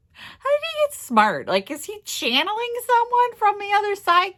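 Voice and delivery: high, loud disbelief; incredulous. Retold or imitated. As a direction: retell it in squeaky, baffled astonishment. Retells her baffled reaction to husband.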